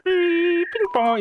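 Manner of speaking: high-pitched modem beeps; imitated dial-up modem. Faking internet connection for scammer, modem imitation. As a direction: mimic a dial-up connection in mouth noises. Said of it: "the dial-up sound, modem sound".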